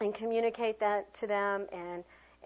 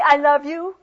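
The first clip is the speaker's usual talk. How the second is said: loud, raised, angry delivery; 5 semitones higher, 12 dB louder than usual. Shown as a demonstration. Teacher repeating the anger demo for class, angry. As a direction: throw it at them angrily. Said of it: "Let me see your anger".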